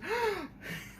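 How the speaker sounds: sharp high gasp of surprise; imitating a startled doll gasping; shocked gasp. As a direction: gasp like you just saw a shotgun. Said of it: "he goes".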